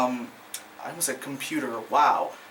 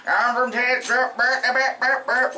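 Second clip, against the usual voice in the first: higher cartoon Texan twang into nonsense syllables; mock Texas accent; 5 semitones higher than usual. Reviewer parodying the actor's sound, exaggerated Texas accent. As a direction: mimic a Texan, dissolving into gibberish twang. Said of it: "like that sound".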